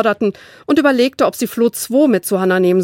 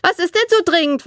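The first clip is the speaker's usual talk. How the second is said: put-on much higher, dismissive little-girl voice; bratty little sister; 10 semitones higher than usual. A narrator's reading. Little sister Caro brushes Flo off while dancing, much higher and dismissive.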